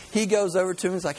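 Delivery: ordinary talk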